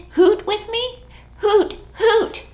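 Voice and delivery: high sing-song hoots; owl hoot. Shown as a demonstration. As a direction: hoot along brightly. Leading kids in hoot.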